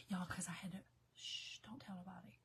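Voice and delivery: hushed, secretive whisper; confiding whisper. Real, not acted. Vlogger confiding to viewers about trouble at home, whispering. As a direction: shush them and whisper it like a secret.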